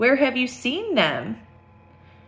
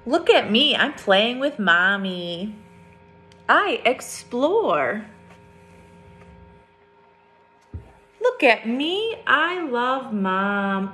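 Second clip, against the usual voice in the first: lifted, sing-song "Look at me"; picture book read-aloud; storybook refrain; 2 semitones higher, slower than usual. A narrator's reading. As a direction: read the refrain brightly for kids.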